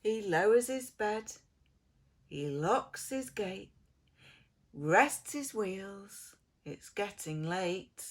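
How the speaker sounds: ordinary delivery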